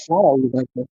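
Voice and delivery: low, teasing question to a friend; affectionate ribbing, not annoyed; joking exasperation. Acted. Looking at your friend and asking, in joking exasperation. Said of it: "looking at your friend and being like".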